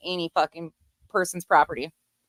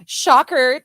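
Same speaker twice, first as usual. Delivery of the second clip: loud mock amazement, pitch climbing; sarcastic shock; 4 semitones higher, 8 dB louder, much slower than usual. Real, not acted. Host reacting to his repeat slur, sarcastic shock. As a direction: feign huge surprise, loud and high.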